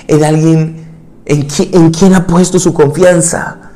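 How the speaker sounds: loud, high fervent preaching; impassioned preaching. Acted. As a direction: drive it home from the pulpit. Preaching at full force. (predicación con toda la fuerza)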